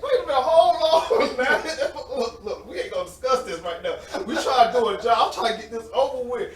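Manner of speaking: high, loud retort through laughter; laughing protest. Retold or imitated. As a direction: protest while cracking up. Retold protest at teasing, laughing.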